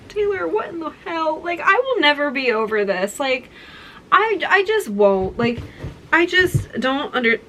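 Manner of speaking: high, giddy, laughing disbelief; overwhelmed disbelief. Real, not acted. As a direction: gush like you can't believe it. Overwhelmed by Taylor's gift.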